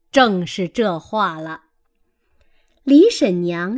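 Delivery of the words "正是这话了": ordinary delivery, nothing marked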